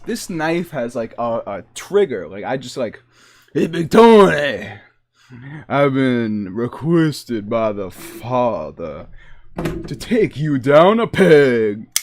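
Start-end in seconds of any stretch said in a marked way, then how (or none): 3.5-11.9 loud mob heavy, drawing out the threat; mobster. Pretend. Mobster sent by the father to Big Tony, loud threat. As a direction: call Tony out like a mob enforcer. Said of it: "did I just walk into a mob bar?".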